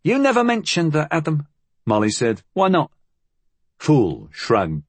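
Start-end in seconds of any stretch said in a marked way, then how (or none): 0.0-1.5 put-on high child's voice; small girl challenging a grown-up; young Molly. A narrator's reading. Young Molly questions Adam. 2.5-2.9 put-on high child's voice; small girl challenging a grown-up; young Molly. A narrator's reading. Young Molly questions Adam.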